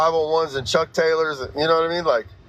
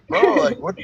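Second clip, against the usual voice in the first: mock confused bro; 8 semitones higher than usual. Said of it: "people look at me like".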